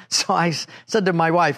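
ordinary talk

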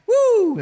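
high cheering whoop. Acted. Preacher mocking the dream of no stress, high cheering whoop. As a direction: whoop of delight.

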